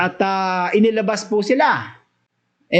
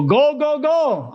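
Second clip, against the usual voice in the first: urgent repeated command; 7 semitones higher, slower than usual. Acted. Preacher voicing the angel sending apostles out, urgent. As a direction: urge them out, rising.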